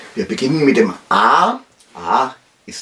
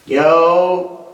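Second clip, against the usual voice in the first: Karl's voice; 4 semitones higher than usual. Acted. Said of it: "ich bin's, der Karl".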